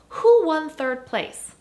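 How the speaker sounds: who won third place? asked high, loud and slow; who punched with a rising, loud voice; stress on who. Shown as a demonstration. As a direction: lean hard on who and draw it out. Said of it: "the question is".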